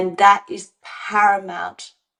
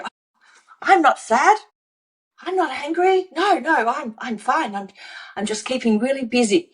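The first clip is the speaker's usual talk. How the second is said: higher, chirpy mock denial; voicing a busy person; 5 semitones higher than usual. Coach voicing someone insisting they're fine, mock breezy. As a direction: brush it off brightly, a touch hammed-up.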